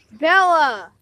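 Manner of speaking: shouting a name across the beach; calling out. Real, not acted. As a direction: call her name loudly. Calling out to friend.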